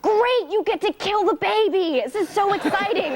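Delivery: mock delighted dad. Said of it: "and he's like".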